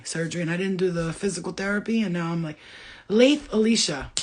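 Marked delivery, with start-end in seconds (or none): 3.1-4.1 Lath Alicia split into two words; mispronounced name. Shown as a demonstration. Fumbling a viewer's name as Lath Alicia. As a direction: say Lath Alicia as two names, slow and loud. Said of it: "Lath Alicia sounds nice too".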